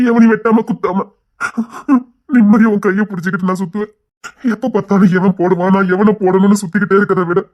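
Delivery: rising to a loud, pained cry; anguished outburst. Acted. Husband crying out his wish to his wife, loud and anguished.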